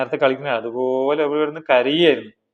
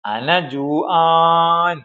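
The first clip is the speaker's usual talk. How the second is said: long, slow cry, a little higher; hungry chick; 4 semitones higher, 3 dB louder, much slower than usual. Acted. Teacher voicing a hungry baby bird, drawn-out cry. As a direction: stretch it out like a hungry nestling calling. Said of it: "അഫ്രാഹുകളിൽ ഒരാൾ പറയണേ".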